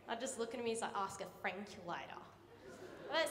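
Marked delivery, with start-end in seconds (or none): 0.9-2.2 lower, soft and lazy; lazy teacher voice. Retold or imitated. Lazy teacher to student without calculator, low and lazy. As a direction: toss it off without caring.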